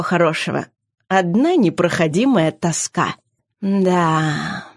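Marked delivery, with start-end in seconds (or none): 3.6-4.6 sad. A narrator's reading. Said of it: "повторила Клиса печально".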